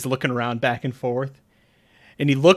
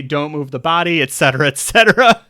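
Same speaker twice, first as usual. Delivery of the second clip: a hint of the message voice, louder; lightly voiced message, a bit raised; character voice; 3 semitones higher, 9 dB louder than usual. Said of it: "You did it in the voice and everything".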